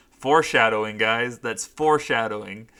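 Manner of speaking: loud, emphatic foreshadowing call; booming foreshadowing declaration; dramatic announcement. Real, not acted. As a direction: proclaim it like a trailer voice. Mock ominous announcement.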